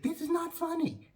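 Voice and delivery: high, stern mock scold; a hint of an indignant caller. Caller scolding the laughing comedian, high indignant voice.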